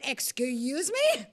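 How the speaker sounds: high, incredulous comeback; offended disbelief. Retold or imitated. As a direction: stretch it out in indignant shock. Offended retort to his remark.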